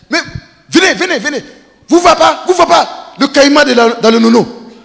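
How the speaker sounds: high, loud urgent calling; calling the children. Retold or imitated. Old man calling his children, high loud urgent shout. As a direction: shout for the kids to come.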